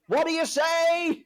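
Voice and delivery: high, slow, drawn-out ref's taunt; imitating Piper's drawn-out taunt; Piper impression. Friend imitates Piper hounding wrestlers to quit. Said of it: "Piper, for the rest of the match, keeps going".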